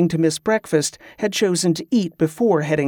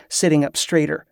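usual speaking voice

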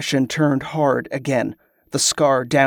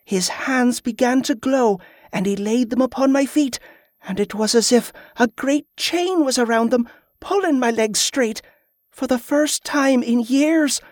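put-on high girl's voice full of wonder; agitated, high-pitched excitement; excited telling; 10 semitones higher than usual. A narrator's reading. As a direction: tell the miracle in a high, eager child's voice. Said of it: "excited at last to tell her tale".

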